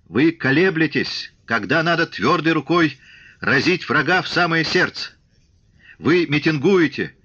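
high, urgent harangue in measured phrases; impassioned oratory. A narrator's reading. Shtokman accusing soldiers of betraying Soviet power, impassioned high oratory.

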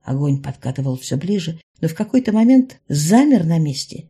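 ordinary delivery, nothing marked

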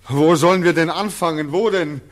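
high, loud, fast anguished shouting; shouted, frantic cry for a start in life; desperate outcry. Acted. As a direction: break out in despair at the man. Returnee bursts out in despair.

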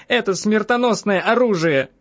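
high-pitched vehement exclamation; agitated woman's outcry; crying out. A narrator's reading. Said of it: "закричала я".

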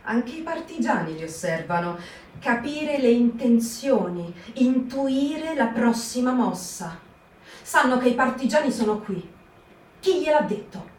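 plain reading voice

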